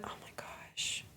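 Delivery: slow, breathless whisper; awed whisper. Pretend. Streamer whispering in awe at a freshly vacuumed carpet. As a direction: sigh it out in hushed awe.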